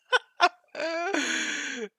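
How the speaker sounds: helpless high cackle; high, loud laugh. Real, not acted. Streamer cracking up before a retry, high loud laugh.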